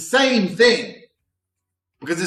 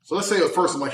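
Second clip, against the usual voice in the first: ordinary delivery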